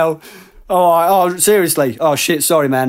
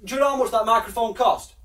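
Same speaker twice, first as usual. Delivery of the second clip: security guard; 8 dB quieter than usual. Acted. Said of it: "that was some good fucking acting".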